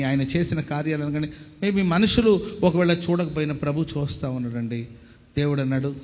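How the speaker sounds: usual speaking voice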